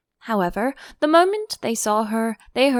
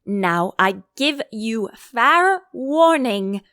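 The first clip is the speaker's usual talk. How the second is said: loud, slow, furious royal threat; shouted warning; 5 dB louder, slower than usual. Acted. Queen shouting a threat at the Duchess, slow and loud. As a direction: bark it at her like a tyrant. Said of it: "shouted the Queen".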